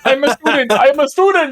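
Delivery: high-pitched mock plea; pleading student. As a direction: imitate a frantic student protesting. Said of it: "like the guy you saw that was like".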